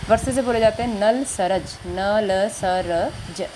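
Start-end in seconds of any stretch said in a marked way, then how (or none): 1.9-3.5 न ल स र ज spelled out very slowly, each sound separate; letter by letter. Shown as a demonstration. Teacher helping pupils memorise letters, letter by letter. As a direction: say न, ल, स, र, ज one sound at a time, drawn out. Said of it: "इसको आप ऐसे भी".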